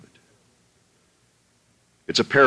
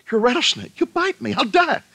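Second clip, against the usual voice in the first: much higher, alarmed voice of the hiker; frightened hiker; 14 semitones higher than usual. Acted. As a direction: recoil in panic from the snake. Hiker refuses the snake.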